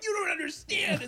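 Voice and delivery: high mocking villain voice; hammed-up high protest; mock villain insisting. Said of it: "He was like".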